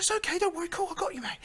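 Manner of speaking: very high, soft British reassurance; very British bloke. Retold or imitated. Random bloke reassuring an upset stranger, high put-on British comfort. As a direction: imitate a British bloke soothing someone, voice pitched right up. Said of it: "he literally sounded that British as well".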